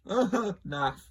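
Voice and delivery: "Naff" said high and loud through a laugh. Shown as a demonstration. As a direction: chuckle out "Naff" as the punchline. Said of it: "You get it?".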